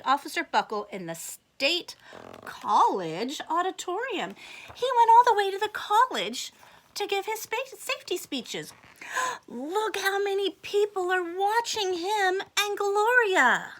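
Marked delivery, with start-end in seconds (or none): 4.7-8.7 excited. Acted. Teacher reads with rising excitement to the class. 9.1-13.7 amazed. Acted. Teacher gasps at the big crowd for the class.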